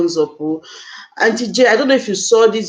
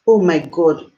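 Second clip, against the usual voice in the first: ordinary talk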